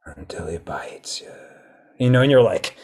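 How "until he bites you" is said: eerie whisper; whispered Quint line. Retold or imitated. Hushed ending of the shark tale as Quint. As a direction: breathe it out as the punchline. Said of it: "you remember Quint from Jaws".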